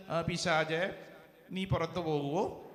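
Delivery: low, soft, hesitant pleading; hammed-up meek little rebuke; mock-timid request. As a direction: ask politely as if afraid to command. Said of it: "അങ്ങനെയാണോ?".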